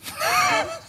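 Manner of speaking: high squeal of a laugh; burst of laughter. Real, not acted. Breaks into laughter.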